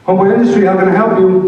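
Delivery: high, loud bright phone greeting; receptionist. Retold or imitated. Receptionist picking up the office phone, high loud greeting.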